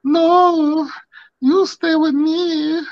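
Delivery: high, drawn-out wail; mock clingy woman. Friend imagining a woman begging him to stay, high mock plea. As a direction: plead in a stretched high voice. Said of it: "she's gonna be like".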